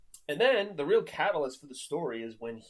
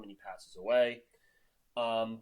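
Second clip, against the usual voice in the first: ordinary delivery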